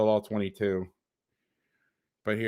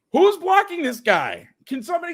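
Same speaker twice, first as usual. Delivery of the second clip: exasperated outburst; 4 dB louder than usual. Real, not acted. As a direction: plead at the screen in real frustration. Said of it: "I, I'm getting mad now".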